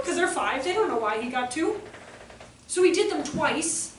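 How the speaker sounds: ordinary delivery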